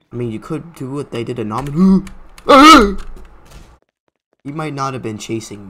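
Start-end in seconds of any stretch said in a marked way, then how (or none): 2.1-3.6 loud, high-pitched gasp; high yelp of fright; startled gasp. Real, not acted. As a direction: gasp sharply at a sudden scare. Startled in horror game.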